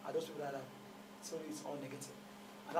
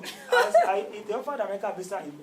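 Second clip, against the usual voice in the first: laughing; 5 semitones higher, 13 dB louder than usual. Real, not acted. Laughing while joking about japa.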